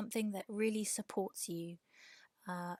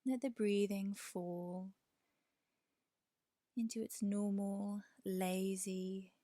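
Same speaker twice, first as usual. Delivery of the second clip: guided meditation. Real, not acted. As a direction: guide them gently toward the breath, unhurried. Leads a yoga nidra meditation.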